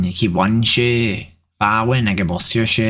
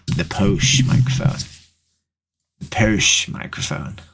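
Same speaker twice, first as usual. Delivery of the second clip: mock posh. Plays up the word posh, repeated.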